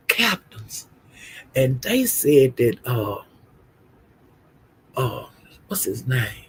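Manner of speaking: soft, halting confiding; hushed gossip. Real, not acted. Gossiper telling viewers who said it, in a hush. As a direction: keep it down while searching for the name. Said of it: "I'ma whisper this here".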